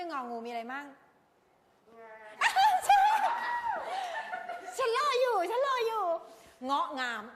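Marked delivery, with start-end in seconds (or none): 1.9-4.7 shrill burst of laughter, very high; squealing laugh; high cackle. Real, not acted. Teacher cackles at class. 4.8-6.0 high, loud sing-song waiting; playfully pushy; mock impatient. Teacher waiting for answers.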